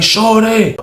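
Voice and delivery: high-pitched, amazed report; retold excited calling. Richmond man raving to Weezy about the hit, retold excited calling.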